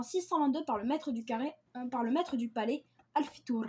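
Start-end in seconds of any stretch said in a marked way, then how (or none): none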